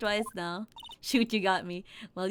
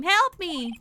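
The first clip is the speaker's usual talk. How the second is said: mock scream for help; 7 semitones higher, 6 dB louder than usual. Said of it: "you scream".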